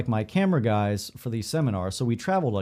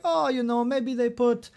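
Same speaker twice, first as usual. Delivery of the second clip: hammed-up Italian accent, pitched way up; bad Italian accent; 11 semitones higher than usual. Airport lady explaining lost luggage, bad Italian accent. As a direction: imitate an airport clerk in a poor high Italian accent. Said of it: "supposed to be a French accent".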